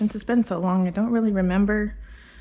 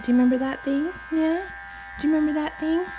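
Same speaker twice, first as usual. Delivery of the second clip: high, rising sing-song; high pet talk; 7 semitones higher, faster than usual. Real, not acted. Groomer showing the dog a tool, high sing-song.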